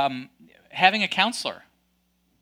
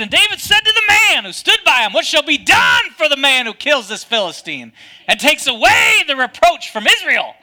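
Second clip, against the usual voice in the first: much higher and louder, full of fight; bold defiant shout; 5 semitones higher, 7 dB louder than usual. Shown as a demonstration. Preacher showing David's real words as a bold defiant shout. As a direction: proclaim it like a warrior calling out the enemy. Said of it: "I think he said it like this".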